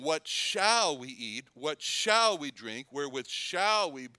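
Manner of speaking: slow questions, each "shall" stretched and stressed. Shown as a demonstration. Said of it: "all these emphasis on the word shall".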